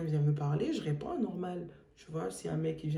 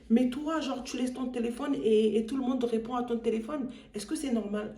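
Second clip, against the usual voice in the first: mocking the caller; 5 semitones higher than usual. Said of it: "il me dit".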